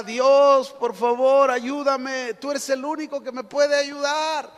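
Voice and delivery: high, pleading retold yell; crying out for help. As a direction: shout up to God as if hanging from a rope. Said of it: "y gritaba".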